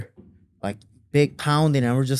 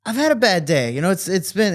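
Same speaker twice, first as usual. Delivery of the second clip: higher-pitched imitation of a dad opening up; acted, hesitant confession in a raised pitch; confiding dad; 8 semitones higher, 5 dB louder than usual. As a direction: haltingly confide as a worn-out dad. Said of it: "he starts confiding to me".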